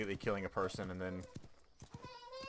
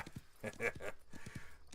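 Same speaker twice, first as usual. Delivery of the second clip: silent wheezing laugh; cracking up at his own voice; breathy laugh; 6 dB quieter than usual. Real, not acted. Gamer laughing at his accent joke.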